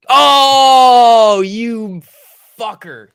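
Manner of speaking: sudden loud high cry; surprised outburst. Real, not acted. Trader shouting at a sudden stock spike. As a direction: blurt it out, caught off guard.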